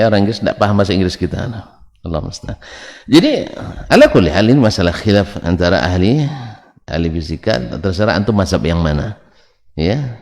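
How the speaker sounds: ordinary talk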